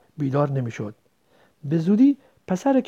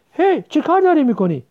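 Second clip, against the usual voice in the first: higher, louder outburst; alarmed protest; 11 semitones higher, 8 dB louder than usual. Acted. Child catching the other tearing a drawing, alarmed protest. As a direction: cry out to stop someone.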